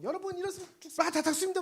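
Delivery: hammed-up alarmist street preacher; mock frantic warning. Pastor mimicking Abraham warning Sodom, mock frantic.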